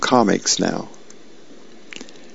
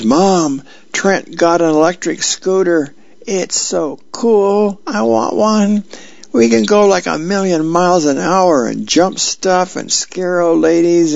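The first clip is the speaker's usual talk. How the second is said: put-on high, breathless kid shouting; excited kid yelling; 8 semitones higher than usual. A narrator's reading. Boy rushes in yelling to mom about a scooter, high excited shout. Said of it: "rushing in to the room and yells".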